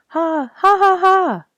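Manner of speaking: high, loud ringing ha-ha; bursting into a big high laugh; hearty laugh. A narrator's reading. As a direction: laugh out loud like a jolly robin. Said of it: "laughed someone nearby".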